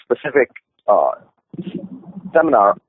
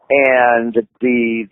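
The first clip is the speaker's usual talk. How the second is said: ordinary talk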